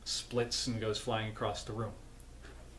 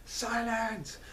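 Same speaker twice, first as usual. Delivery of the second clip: high-pitched imperious queen outburst; Red Queen; 9 semitones higher than usual. Retold or imitated. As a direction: rattle off royal orders in a mock-furious high voice. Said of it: "the Red Queen's like".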